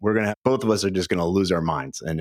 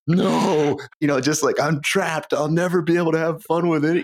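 mock panic; 9 semitones higher than usual. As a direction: play up the fake despair. Retelling his honeymoon panic.

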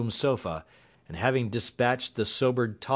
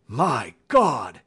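loud cry in a character voice; horrified cry; 2 semitones higher, 5 dB louder than usual. Acted. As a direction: cry out in horror. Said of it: "he cried".

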